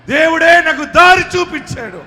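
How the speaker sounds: fervent preacher claiming victory; preaching at full force. Acted. Preacher leads prayer at full force.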